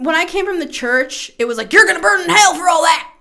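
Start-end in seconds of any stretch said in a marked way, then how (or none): 1.7-3.1 mock hellfire preacher. Mock preacher from old church.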